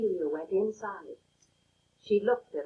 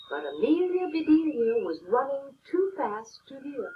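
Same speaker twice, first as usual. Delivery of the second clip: plain reading voice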